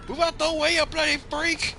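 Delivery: put-on high, loud Irish-accented shout; angry Irishman. Brother heard telepathically from afar, angry Irish scream. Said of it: "this angry Irishman".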